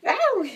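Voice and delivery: high, loud yelp when the cat headbutts; a real yelp of surprise; pained yelp from a hard headbutt; sudden pained yelp. Real, not acted.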